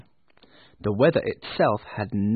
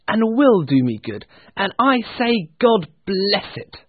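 high, building speech; impassioned nephew; 8 semitones higher, 5 dB louder than usual. Acted. Nephew defending Christmas to his uncle, impassioned. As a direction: build from reasoning to a ringing blessing. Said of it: "replied the nephew".

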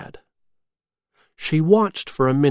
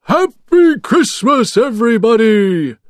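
jolly shout; 9 semitones higher, 8 dB louder than usual. A narrator's reading. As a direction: call it out across the sky. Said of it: "shouted Santa Claus".

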